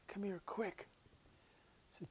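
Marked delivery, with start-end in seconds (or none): 0.1-0.9 weak whispered call. Retold or imitated. Dying woman calls weakly.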